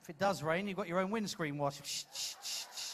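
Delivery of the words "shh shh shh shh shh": soft unvoiced shushing; hushing. Real, not acted. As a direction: hush them gently. Hushing the audience.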